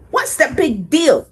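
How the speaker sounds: high, loud indignant question; pigeon protest. Acted. Pigeon protesting the bus driver's rule. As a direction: demand it, offended.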